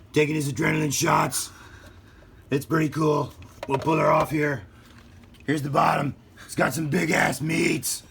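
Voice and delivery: Pete Holmes Batman. Retold or imitated. Unboxing the Batmobile for kids, as a hammed-up Batman. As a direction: play a boastful cartoon Batman. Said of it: "my best Pete Holmes Batman impersonation".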